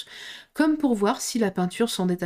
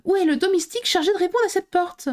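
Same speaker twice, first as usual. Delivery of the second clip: higher, indignant demand for the servant; scolding demand; 10 semitones higher, 3 dB louder than usual. Acted. Alice scolding whoever answers the door, indignant. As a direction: tell them off sharply. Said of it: "prête à réprimander".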